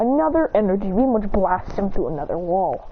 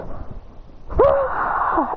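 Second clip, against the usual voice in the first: high, breathy scream; acted scream. He screams as fire shoots from his hands, breathy scream. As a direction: let out a long airy scream. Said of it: "as he screams".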